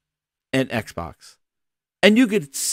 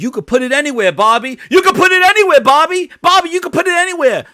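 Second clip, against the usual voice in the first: high, loud, fast gushing come-on; gushing sales pitch; 12 semitones higher, 8 dB louder, faster than usual. Retold or imitated. Phil courting Bobby, high and loud gushing pitch. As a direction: oversell it like a smitten suitor.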